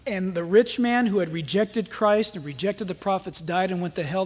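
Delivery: ordinary delivery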